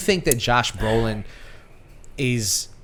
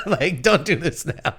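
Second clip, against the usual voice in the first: laughing; 4 semitones higher than usual. Real, not acted. Laughing about tough dads.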